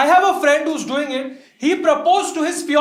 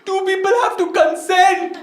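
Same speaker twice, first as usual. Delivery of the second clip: higher, slower indignant protest; angry sister; 6 semitones higher, slower than usual. Retold or imitated. Sister arguing with her dad about consent, high and indignant. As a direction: spell it out to dad, outraged. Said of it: "my sister gets angry, she's crying".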